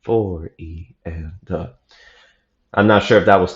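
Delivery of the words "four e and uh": very slow, even beat count. Shown as a demonstration. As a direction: count out each subdivision slowly and softly. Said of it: "going thirty beats per minute".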